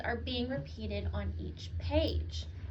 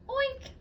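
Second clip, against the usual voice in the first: squeaky high pig oink; silly high oink; 11 semitones higher than usual. Acted. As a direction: oink like a piglet. Dog makes pig sound.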